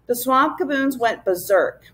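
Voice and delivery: ordinary delivery, nothing marked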